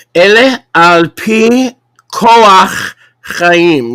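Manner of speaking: slow, carefully spaced Hebrew; over enunciating. Shown as a demonstration. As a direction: sound out each syllable slowly. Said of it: "Let me say that slowly".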